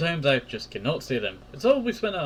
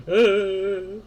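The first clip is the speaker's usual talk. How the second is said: a high, loud, hammed-up crying "Ah"; mock sob; 8 semitones higher, 6 dB louder than usual. Joking about two friends sobbing over the phone, mock wail. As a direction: wail it like a cartoon crier. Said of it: "just hearing two people just".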